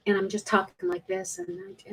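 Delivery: drained, half-hearted delivery sinking almost to nothing; low-energy demo. Shown as a demonstration. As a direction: show a listless, deflated version of yourself. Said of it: "Do you see the change?".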